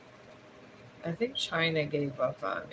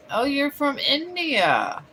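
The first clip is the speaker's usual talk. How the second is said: excited greeting; 9 semitones higher, 6 dB louder, slower than usual. Real, not acted. Greeting a viewer from India.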